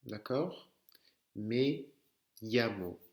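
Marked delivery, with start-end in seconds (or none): 1.4-3.0 ME LLAMO in separate words, LL as Y; double L en Y. Shown as a demonstration. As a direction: model llamo as "yamo". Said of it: "Ça se prononce comme un Y". (LL prononcé comme un Y)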